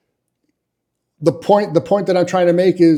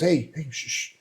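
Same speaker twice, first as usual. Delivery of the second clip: imitated shushing; 9 dB quieter than usual. Coworker hushing him at work.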